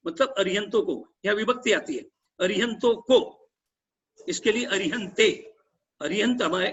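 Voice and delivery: ordinary delivery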